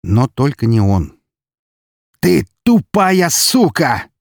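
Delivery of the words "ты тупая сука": slow, high, loud roar of rage; drawn-out enraged shout; furious yell. A narrator's reading. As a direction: scream the insult word by word. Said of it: "заорал он".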